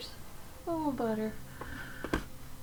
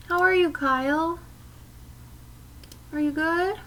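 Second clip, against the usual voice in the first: ordinary talk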